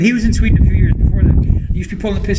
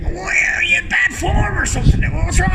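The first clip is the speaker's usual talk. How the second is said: high-pitched, strained mock outburst; put-on high scene-acting voice; imitated TV acting; 11 semitones higher than usual. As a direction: act out a scene in a hammed-up high voice. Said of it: "that acting there, he's like".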